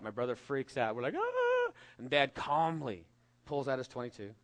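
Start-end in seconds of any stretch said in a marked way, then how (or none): 1.2-1.7 high shriek of fright; kids' scream. Retold or imitated. Kids seeing a scorpion, high-pitched shriek. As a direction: shriek like a scared little kid. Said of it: "and I freak out".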